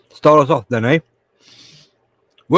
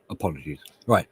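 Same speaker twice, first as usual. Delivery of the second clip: ordinary talk, nothing marked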